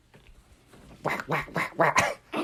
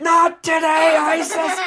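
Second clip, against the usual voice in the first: high, loud mock war cry; heroic battle yell; 4 dB louder, slower than usual. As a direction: shout defiantly as you leap to attack. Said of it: "And Jerry said".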